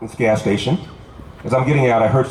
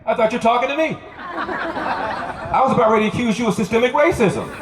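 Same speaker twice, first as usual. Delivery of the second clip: laughing; 8 semitones higher than usual. Real, not acted. Telling joke through laughter.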